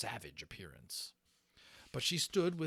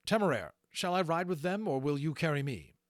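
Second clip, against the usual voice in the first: Lawrence's voice; 7 semitones higher, 8 dB louder than usual. Acted. Lawrence asks Temeraire.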